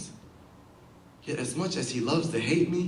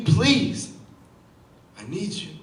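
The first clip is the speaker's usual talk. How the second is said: agonized plea; slower than usual. Acted. Man begging the poet for help, acted agonized pleading. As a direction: plead in mock agony, pausing after the first word. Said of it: "pleads his pleas of agony".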